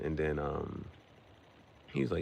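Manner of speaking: normal talking voice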